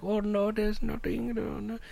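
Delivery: put-on soft, high German-accented little lady; mock a timid lady in a German accent. Timid passenger hunting for a seat, soft little German lady. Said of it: "Why do you sound like a little German lady".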